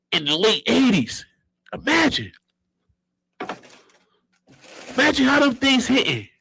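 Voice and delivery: high, amazed sneaker hype; hyped up. Real, not acted. Remembering first seeing the Jordans, high and hyped. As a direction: sell the wonder of it.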